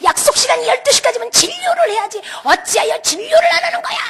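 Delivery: very high, outraged yelling; screaming complaint. Retold or imitated. Parent demanding treatment from the hospital, high furious yelling. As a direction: re-enact a screaming rant, pitch climbing. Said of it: "막 소리 소리를 지르고".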